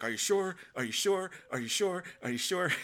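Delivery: high, loud, nagging repeats; mock sitcom pestering; rising anxious Are you sure, over and over; IT Crowd impression. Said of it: "like I T Crowd in episode one".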